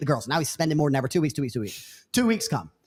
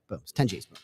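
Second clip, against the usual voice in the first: usual speaking voice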